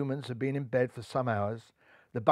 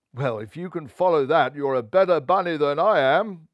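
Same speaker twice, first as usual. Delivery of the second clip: put-on wise old rabbit; 5 dB louder than usual. A narrator's reading. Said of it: "smiled Hoban, the wise old rabbit".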